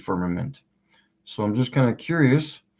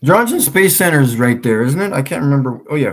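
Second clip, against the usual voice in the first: loud; 5 semitones higher, 8 dB louder, faster than usual. Real, not acted. Excited rambling while searching numbers.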